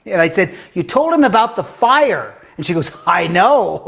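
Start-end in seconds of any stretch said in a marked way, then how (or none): 0.7-2.3 much higher, louder retold exclamation; urgent confrontation. Teacher to little girl about her telling dad, urgent exclamation. 3.1-3.8 louder, put-on kid exclamation; gleeful child. Girl owning up to her teacher, gleeful.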